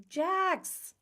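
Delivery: high, sharp mock call of a name; imitated call. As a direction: replay the yell for the camera. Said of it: "Hold on, I'll show you".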